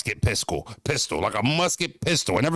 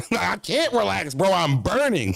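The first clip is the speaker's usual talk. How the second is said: normal talking voice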